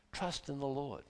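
hushed, falling to rest; very soft sincere conclusion; soft closing refrain. Real, not acted. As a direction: let it settle quietly and sincerely.

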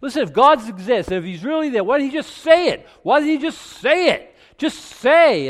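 high, loud, exasperated demand; mocking skeptic's demand. Skeptics demanding that God just speak, high loud exasperated shout. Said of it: "I've had people say".

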